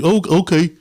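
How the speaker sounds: meek compliance. Retold or imitated. Member gives in to police: high meek stammered okay. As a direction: cave in meekly.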